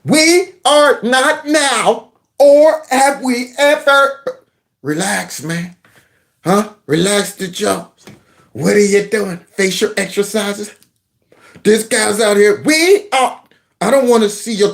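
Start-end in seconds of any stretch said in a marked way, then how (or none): 0.0-4.4 very slow, high, over-articulated words; mock someone stretching the jaw on every word; mimicking wide-mouthed speech. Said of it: "open their mouth that wide when you talk". 12.6-13.4 "We are" high and over-opened; mock the jaw-stretching delivery of "We are"; mimicking wide-mouthed speech. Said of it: "open their mouth that wide".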